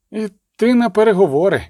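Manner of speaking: ordinary delivery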